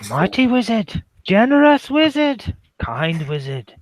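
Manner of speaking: high, drawn-out flattering address, easing down at the end; fawning goblin flattery. Acted. Goblin player hailing a mighty wizard, high-pitched fawning.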